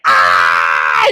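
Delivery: short loud yelp, low in the throat; pained cry. Retold or imitated. Harry yelping at Voldemort's touch, loud pained cry. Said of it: "and Harry's like".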